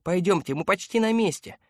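put-on high, eager little-girl voice; excited girl calling. A narrator's reading. Girl telling adults they are almost there, excited calling. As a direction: urge them onward with childlike excitement. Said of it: "возбуждённо кричала она".

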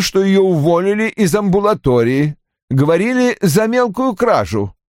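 put-on high-pitched, emphatic older father; Roy's agitated character voice. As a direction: play an indignant father, pitched well up.

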